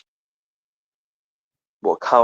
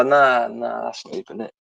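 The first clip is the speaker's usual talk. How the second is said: normal talking voice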